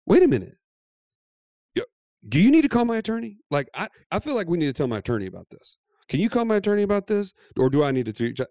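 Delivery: high, fast, self-important mock demands; wife's power trip. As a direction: imitate someone suddenly thrilled by her own importance. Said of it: "power trip just came out of nowhere".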